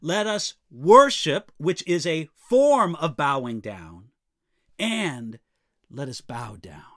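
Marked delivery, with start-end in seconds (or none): 0.0-1.5 "worship" punched loud; intensified emphasis. Shown as a demonstration. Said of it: "it's given even more intensity through repetition". 5.9-6.8 hushed, lowered "let us bow down"; reverent repetition. Shown as a demonstration. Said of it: "it's given even more intensity through repetition".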